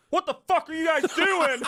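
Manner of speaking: high, loud angry shout; high, loud shocked yell; mock outrage. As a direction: bark it high from the stall. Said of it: "the guy in there is like".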